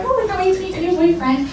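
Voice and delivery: high-pitched mock excitement; imitated squeal of anticipation; excited friends. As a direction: gush like eager friends on a visit. Eager visiting friends, acted out.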